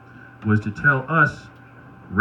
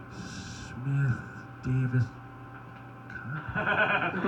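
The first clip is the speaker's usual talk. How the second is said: very soft, halting mumble of names; tired sign readers; 4 semitones higher, 14 dB quieter, much slower than usual. Retold or imitated. Tired passengers looking for their car, softly reading. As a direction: imitate someone reading signs under their breath. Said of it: "they're tired".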